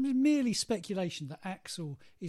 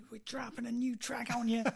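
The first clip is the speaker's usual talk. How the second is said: [name] impression; 6 semitones higher than usual. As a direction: announce it like an ageing rock singer. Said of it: "It sounds just like [name] when you did it".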